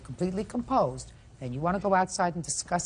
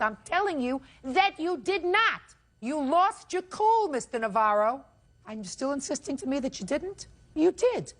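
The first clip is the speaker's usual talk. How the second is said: sarcastic scolding tv judge; 8 semitones higher than usual. Real, not acted. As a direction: scold him hard from the bench.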